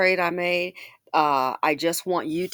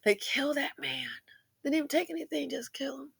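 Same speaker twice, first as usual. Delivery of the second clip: grieving whisper, voice barely there; emotional whisper; 6 semitones higher, 5 dB quieter than usual. Real, not acted. Vlogger mourning the murdered shop owner, a grieving whisper. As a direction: let the grief pull it down to a whisper. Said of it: "sometimes I get emotional".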